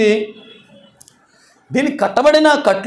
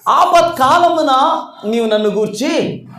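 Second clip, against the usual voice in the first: slow dictation; 3 semitones higher, slower than usual. Shown as a demonstration. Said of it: "pastor dictates verse again".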